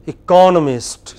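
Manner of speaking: loud, clear eCONomist for repetition; stress on second syllable. Shown as a demonstration. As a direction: say "economist" punching CON. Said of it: "I will say it first".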